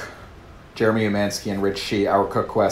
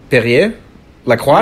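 French-accented brand names, tentative and questioning; French pronunciation, checking it's the right one; 5 semitones higher, 12 dB louder than usual. Shown as a demonstration. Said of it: "Say it with a French accent".

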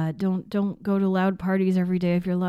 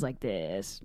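low, slow creaky fry; vocal fry; 10 semitones lower, 9 dB quieter than usual. Shown as a demonstration. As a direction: drop into a crackly creak, slowly. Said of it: "vocal fry".

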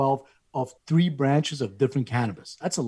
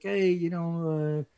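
lightly voiced lifeguard, slow and higher; drawn-out, hesitant mock sales pitch; half-hearted lifeguard impression, words stretched out; imitated Jamaican lifeguard; 4 semitones higher, much slower than usual. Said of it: "I'm not gonna do a Jamaican accent".

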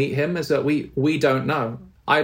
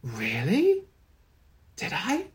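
surprised father; 3 semitones higher, 4 dB quieter, much slower than usual. Retold or imitated. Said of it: "he was like".

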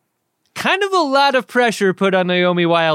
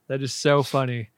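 usual speaking voice

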